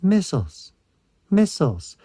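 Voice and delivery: lightly voiced arcade machine callout; arcade announcer voice. Remembering the arcade game's missile pickup callout. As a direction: call it out like the Cyber Sled game. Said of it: "and it goes".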